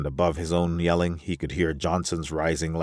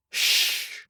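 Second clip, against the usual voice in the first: long unvoiced shush; hushing. Alvar silencing the others, drawn-out shush. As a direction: hush them with pure breath.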